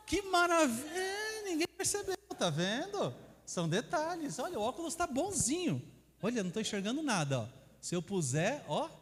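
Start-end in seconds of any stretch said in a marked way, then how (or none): 0.1-5.8 high, bright mock delight. Real, not acted. To the audience, about the slides, high delighted exclaim. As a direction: marvel at being able to read again.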